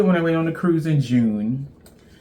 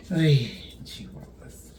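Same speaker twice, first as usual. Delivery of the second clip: slow whispered exclamation, much softer; whispered mild oath; 5 dB quieter, slower than usual. Real, not acted. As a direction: whisper the mild curse, slowly. Mock oath at the mess.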